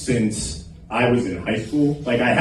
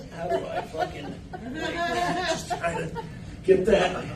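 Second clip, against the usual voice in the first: squeaky high frustrated whimper; pinched high voice, baffled by the trickle; frustrated squeak; 13 semitones higher, 9 dB quieter than usual. Acted. Guest stuck under a dead shower, high pinched whine.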